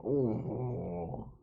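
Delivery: ordinary delivery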